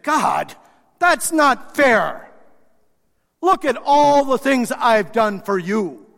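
loud, high, slow aggrieved complaint to God; indignant protest. Acted. Believer complaining to God, loud aggrieved indignation. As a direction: demand credit, wounded and loud. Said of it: "and said".

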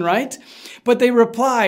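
normal talking voice

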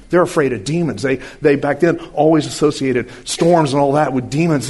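ordinary delivery

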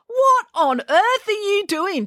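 very high, slow and incredulous; high-pitched indignant demand; outraged demand. A narrator's reading. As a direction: demand it, stretching each word high. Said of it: "demanded April".

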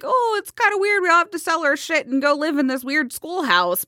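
high, casual follower voice; mildly uneasy cult member. Retold or imitated. Cult members told to sell up, lightly voiced high unease.

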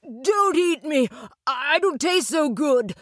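high-pitched frightened plea; trembling boy. A narrator's reading. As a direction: beg for your life as a scared lad. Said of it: "he trembled".

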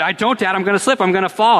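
high, mock-panicked child protesting; imitated panic. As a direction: imitate a frightened kid up a tree. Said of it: "the panic is in his voice".

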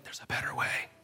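a soft, unvoiced nudge; gentle whisper. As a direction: whisper it softly, as the Spirit. Spirit speaks gently to believer.

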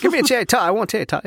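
high mock British accent. Co-host joins the accent joke, mock British.

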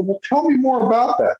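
high, keen prompt; eager interviewer. Retold or imitated. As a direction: lean in like a curious reporter.

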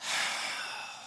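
long weary unvoiced sigh; deep sigh. Shown as a demonstration. Preacher showing how people sigh at demands, deep sigh. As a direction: let out a heavy tired breath. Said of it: "You go".

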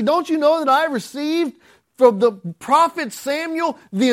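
high-pitched, mock-indignant demand; indignant David. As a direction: play David as entitled and offended. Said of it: "he got indignant".

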